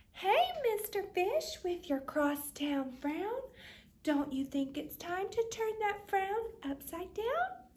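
a sunny clam nudging her pal; cheery clam. Acted. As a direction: coax him out of his frown. Clam cheerfully advises fish.

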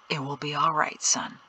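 low, soft, private murmur; hushed reassurance. A narrator's reading. Father calms his worried son, hushed. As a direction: reassure him under your breath. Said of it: "Peto whispered back".

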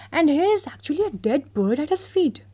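mayor. Acted. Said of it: "continued the mayor".

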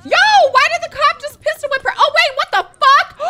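high, loud incredulous yelling; shocked shouting. Real, not acted. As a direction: shriek the question in disbelief. Shocked by cop's attack.

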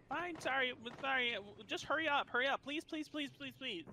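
high, stammering mock begging; imitated pleading. Karen begs staff to hurry, high imitated pleading. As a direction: plead in a high voice, repeating yourself. Woman gives in and pleads, retold.

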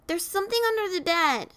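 put-on high-pitched child's voice; a small boy alarmed at bedtime; frightened little boy. A narrator's reading. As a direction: cry out like a worried kid, pitched high. Frightened child calls to father.

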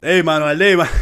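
loud call across the room. Real, not acted. Calling out to Manuel, a loud hail. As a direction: shout his name to get his attention.

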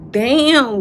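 usual speaking voice

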